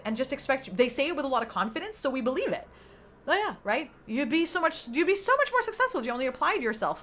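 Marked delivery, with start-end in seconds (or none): 3.3-7.0 raised pitch mock confident advice; confident nagging quote. To the listener, mimicking a confident adviser, raised pitch. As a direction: parrot her sure tone. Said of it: "she said it with a lot of confidence".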